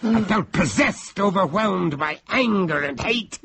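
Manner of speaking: high, overwrought storytelling; impassioned narration. Acted. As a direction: narrate as if seized by rage. Narrator relives overwhelming rage.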